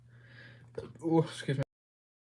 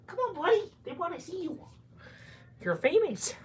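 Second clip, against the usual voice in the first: coaxing pet talk; 4 dB louder than usual. Real, not acted. Owner coaxes the cat to meet the viewers, high cooing pet talk. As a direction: coax a pet in a squeaky-high voice.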